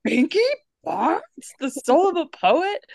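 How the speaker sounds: high incredulous question; lightly voiced disbelieving teacher. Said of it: "Mister Ratburn is like".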